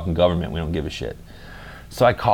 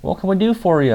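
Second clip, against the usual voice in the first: higher, friendly server voice; high cheerful waitress; 10 semitones higher, faster than usual. Retold or imitated. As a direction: ask sweetly like a diner waitress. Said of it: "I'm like".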